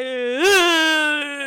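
mock breakdown the moment she gets home; mock sob. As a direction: burst into fake tears. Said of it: "I'm like".

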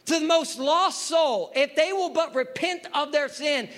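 high, emphatic pulpit preaching; forceful preaching. Acted.

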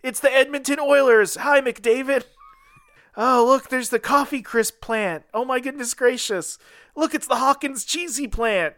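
high, bright tourist voice; cheerful balloon. Pretend. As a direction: gawk like an excited sightseer. Balloon sightseeing over Canada.

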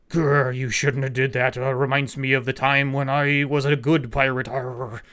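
growling pirate captain voice; pirata gruñón. Retold or imitated. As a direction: growl it out like a hammed-up old sea dog. Knuckles acted as growling pirate captain.

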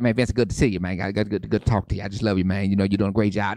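low, easygoing gangster-style pastor; lightly put-on low, tough-guy talk; Wade Bryant. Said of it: "That's how he talked to me".

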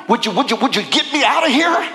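fast, breathless begging; mock pleading. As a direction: rattle off the pleas like a desperate prisoner. Imagined letter begging from prison.

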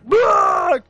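shouted cheer. Retold or imitated. Crowd yelling at the band after the Death cover, loud shout. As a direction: yell it like a fan at a metal show.

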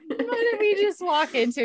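high-pitched incredulous question; mock disbelief. [name] watching family drama erupt, mock incredulous. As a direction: exclaim it, utterly baffled.

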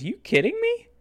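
very high squeaked question; pitched-way-up incredulous retort; mock incredulity. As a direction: imitate a friend in amused disbelief. Friend laughing in disbelief, retold.

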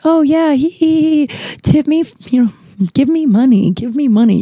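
high, giggly fake flirting with customers; sugary mock flirt, a touch high; mock flirty. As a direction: sweet-talk them for tips with mock giggles. Fake flirting with Trump-shirt customers.

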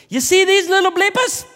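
high, loud Irish woman voice; hammed-up Irish lady pointing at the kids; drunk Irish lady accent. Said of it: "Drunk Irish woman in a pub".

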